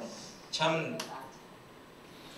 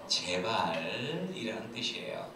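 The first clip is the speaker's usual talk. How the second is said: usual speaking voice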